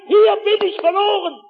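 high, loud panicked shout; scream. Retold or imitated. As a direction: shout for rescue. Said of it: "Ich konnte noch schreien". (panisch laut schreiend)

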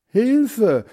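high, loud storybook shout; cry for help. A narrator's reading. Boy sinking in the ditch, crying out for help. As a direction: call for help as you sink. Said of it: "rief Hans Frieder".

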